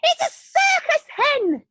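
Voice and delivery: very high, loud call; old woman shouting from the roof; delighted shout. Acted. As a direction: cry out in wonder from afar. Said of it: "Grandma shouted from the rooftop".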